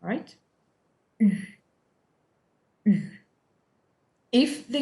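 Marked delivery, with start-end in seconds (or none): 1.2-3.0 "U" said as French u, tongue flat, lips in a tight circle; French u sound. Shown as a demonstration. Teacher modelling French "U" for students, lips rounded. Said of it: "nothing moves in your mouth".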